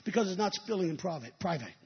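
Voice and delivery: usual speaking voice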